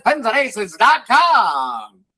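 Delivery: slow, high radio announcer sign-off; drawn-out vintage radio read; old-timey radio voice. Acted. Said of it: "old-timey radio voice".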